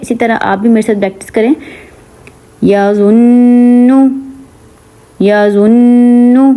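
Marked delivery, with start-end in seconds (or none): 2.6-6.5 یا زنو slow, the doubled ن sung through the nose; nasal ghunna. Shown as a demonstration. Teacher has students repeat the ghunna. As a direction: hold the ن of زنو nasal and long. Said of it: "ناک میں آواز گئی".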